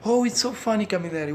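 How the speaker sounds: high, delighted "so funny"; excited Italian fan. Retold or imitated. Italians telling him the book is funny, delighted. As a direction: gush about Camilleri. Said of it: "I talk to Italians and say".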